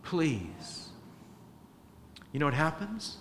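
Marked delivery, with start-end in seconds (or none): 0.0-0.8 soft, slow plea; God's tender slow plea; gentle pleading. Retold or imitated. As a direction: beg softly and slowly. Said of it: "the Lord is pleading".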